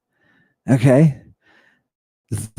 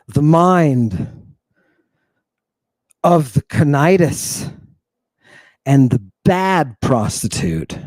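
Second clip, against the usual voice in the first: slow, hushed, weighty recital dropping lower; dramatic poetry reading; 6 semitones lower, slower than usual. Acted. Teacher reciting Archilochus to class, slow and grave. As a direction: read it like a solemn oracle.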